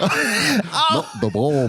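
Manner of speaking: high, slow, hammed-up Australian accent; Australian accent attempt. Comic repeating Nicole line for laughs, in exaggerated Australian accent. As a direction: wail it slowly in a bad Australian accent. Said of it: "I Men in Blacked my accent".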